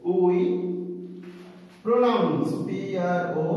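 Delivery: word by word, with long pauses; slow dictation. Shown as a demonstration. Teacher writing out the consonant definition, slow dictation. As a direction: dictate it very slowly, piece by piece.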